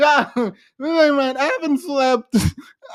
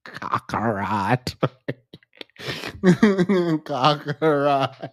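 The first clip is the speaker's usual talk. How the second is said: eerie low whisper; hushed anime whisper; 8 semitones lower, 4 dB quieter, much slower than usual. Pretend. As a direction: breathe the name out slowly.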